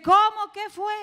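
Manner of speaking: high, loud half-deaf man's "what?"; loud puzzled question. Retold or imitated. Half-deaf man in a wheelchair asks her to repeat, loud and high. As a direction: call out as if you can't hear a thing.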